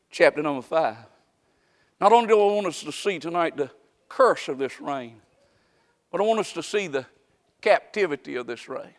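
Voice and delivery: ordinary delivery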